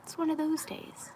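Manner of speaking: high, sing-song "oh yeah, breakdown"; a lightly mocking high inner voice; mock realization. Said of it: "and it's like".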